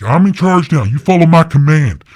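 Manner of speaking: high, loud barked orders; commanding yell. Retold or imitated. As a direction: take charge and yell every order. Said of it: "Jeremiah's just yelling".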